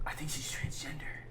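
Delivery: soft breathy whispered secret. Retold or imitated. Drunk homie whispering his suspicion, whispered. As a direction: whisper it like gossip.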